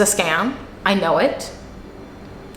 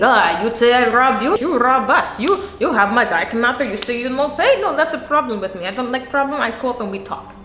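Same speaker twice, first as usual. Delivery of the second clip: higher-pitched mock Russian boss; imagined Russian accent; 3 semitones higher than usual. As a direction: imitate an indignant Russian in broken English. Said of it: "Imagine this being Russian, though".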